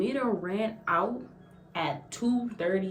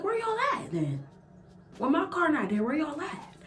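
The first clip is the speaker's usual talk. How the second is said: exasperated outcry; 11 semitones higher than usual. Retold or imitated. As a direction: throw the question up high in disbelief. Panicking, car gone, no police.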